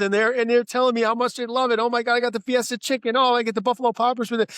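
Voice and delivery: high, loud, gushing imitation of an excited friend; excited friend retold. Friend retelling, burst of excitement.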